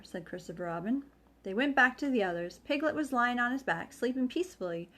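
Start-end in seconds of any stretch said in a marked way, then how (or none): none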